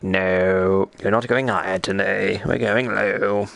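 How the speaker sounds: acted-out low register. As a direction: drop the pitch and play your own stubborn voice. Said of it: "my voice is like".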